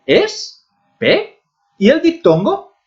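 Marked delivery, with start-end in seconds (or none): 0.0-1.4 Es, pe as separate high beats; syllable by syllable. Shown as a demonstration. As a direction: pause between "Es" and "pe". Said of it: "formada por tres sílabas".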